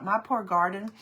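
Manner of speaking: usual speaking voice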